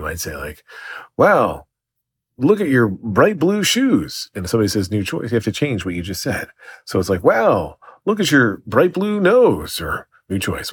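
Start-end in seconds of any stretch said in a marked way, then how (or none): 1.2-4.3 high, slow, drawn-out exclamation; mock excited improv line. Improv example line, acted out. 7.2-9.8 high, slow, drawn-out exclamation; mock excited improv line. Improv example line, new choice.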